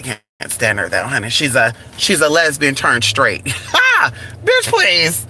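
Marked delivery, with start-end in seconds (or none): 3.5-5.2 dismissive outburst. Real, not acted.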